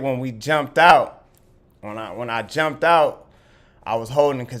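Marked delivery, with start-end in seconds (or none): none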